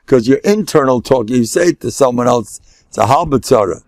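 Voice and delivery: normal talking voice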